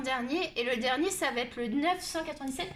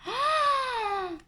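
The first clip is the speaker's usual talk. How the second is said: long, high, drawn-out "oh"; indignant gasp; 10 semitones higher than usual. Real, not acted. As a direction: cry out in high-pitched indignation. Indignée de l'incompétence de la vendeuse. (long « oh » aigu et indigné)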